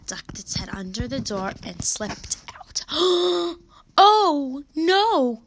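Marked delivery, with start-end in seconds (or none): 2.9-3.5 sharp, startled gasp. A narrator's reading. Narrator as the chick slips out, alarmed gasp. 4.0-5.4 high, loud cry of dismay; alarmed cry. A narrator's reading. Mary sees the chick escape, alarmed cry. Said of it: "cried Mary".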